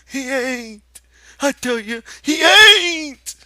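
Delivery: high, rising to loud; desperate denial. Acted. Lenny covers his ears against the rabbit, desperate.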